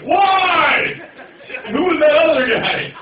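high, loud wail; anguished mock cry. Acts out partner's cry afterwards.

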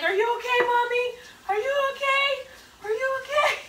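small, high, worried little voice; imitating Ryan. Sister voices Ryan checking on mom lying on floor, high worried plea. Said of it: "Ryan's like".

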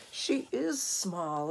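usual speaking voice

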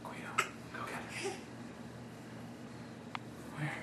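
urging whisper. Real, not acted. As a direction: breathe it to the dog. Whispered command to dog.

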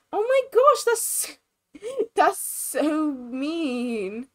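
high, amused shock with a drawn-out ending. Real, not acted. Player reacting to a mean post, scandalized. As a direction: squeal it, laughing in disbelief.

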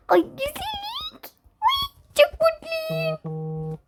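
very high, slow, whiny delight; squeaky drawn-out joy; high mock-happy voice. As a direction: coo it in a high warped voice. Voice transformed in game.

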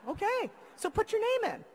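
a high, sing-song take on the boss; high mock boss voice. Boss's voice in the retold exchange.

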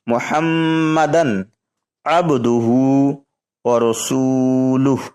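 measured chant of the shahada; kalima recitation. Shown as a demonstration. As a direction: recite slowly with stretched vowels. (শাহাদাত তিলাওয়াতের সুরে পড়া)

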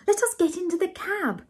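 high-pitched calling voice; Ethel crying out. A narrator's reading. Ethel hurries him into the cab, high cry. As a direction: urge him along in a high voice. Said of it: "cried Ethel".

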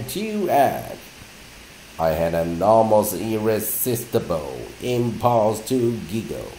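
slow, careful reading of a dictionary entry. Acted. Teacher reading English entries to students, slow and deliberate. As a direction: read each definition out slowly and evenly.

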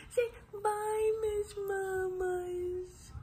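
very high, long-drawn coo. Real, not acted. Mother coaxing baby to say goodbye.

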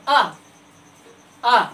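open-throated "आ" held out; sounding the vowel a. Shown as a demonstration. Teacher to children demonstrating the vowel sound, held. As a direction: let "आ" ring from the throat, no lips. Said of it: "अब बोलिए पहला अक्षर".

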